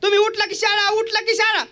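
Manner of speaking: high, complaining wife's tirade; wife snapping back. Acted. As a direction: list every chore she does, indignant. Wife snaps back at husband.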